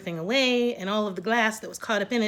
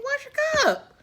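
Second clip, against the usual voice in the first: mock sarcastic whine; 4 dB louder than usual. Repeating daughter's sarcastic line.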